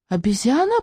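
put-on high-pitched little-kid squeak; squeaky. A narrator's reading. Small boy echoes the waiter's menu, squeaking. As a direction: squeal it as a startled question. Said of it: "пропищал Жан Д".

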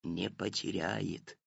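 soft, slow, low hush; reassuring whisper. A narrator's reading. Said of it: "уверил её папа".

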